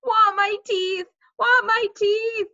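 high, loud, drawn-out wail; toddler screaming. A narrator's reading. Fudge learns two teeth are gone, screaming. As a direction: bawl it like a small child. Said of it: "Fudge started to scream".

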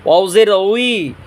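"ওয়াও জের উই" slowly with rounded lips; rounded-lip WAW. Shown as a demonstration. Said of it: "ঠোঁট গোল করিয়া".

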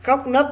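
"Khóc nấc" pitched high and loud, each word hit hard; teacher models "Khóc nấc" with strong emphasis; stress on khóc nấc. Shown as a demonstration. Teacher demonstrates to pupils: "Khóc nấc" emphasized high and loud. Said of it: "những từ này khi đọc các con chú ý là đọc nhấn giọng".

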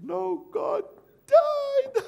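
high squealed wail breaking into sobs; hammed-up crying wife; mock sobbing. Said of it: "you know how the ladies cry".

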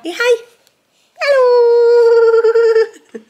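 long high sung hello. Real, not acted. Mother greeting her baby daughter, long high singsong hello.

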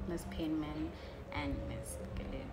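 usual speaking voice

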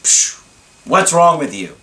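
scoffing "Psh" then an exasperated "what's wrong with you"; hammed-up irritated scoff; upset scoff. Girlfriend scoffing at boyfriend home late, irritated. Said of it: "she got really upset and just".

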